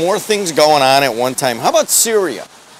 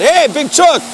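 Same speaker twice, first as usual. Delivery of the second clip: calling out; 12 semitones higher than usual. Real, not acted. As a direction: shout a greeting to a friend passing by. Calling out to passerby.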